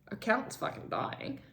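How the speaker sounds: breathy whispered aside under her breath; whispered dry aside. Real, not acted. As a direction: drop to a whisper for the bad news. Muttered aside about dying account.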